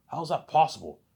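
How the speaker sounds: shocked disbelief. Retold or imitated. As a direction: blurt it out in shock. Shocked reaction in retold story.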